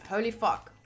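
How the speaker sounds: ordinary talk, nothing marked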